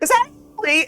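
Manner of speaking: high character voice. Acted. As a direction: voice the dad, high and bright. GM voices NPC.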